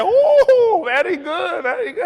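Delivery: very high, loud cooing; hammed-up high delight; gushing praise. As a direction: imitate a thrilled customer praising. Customer gushes over his Spanish.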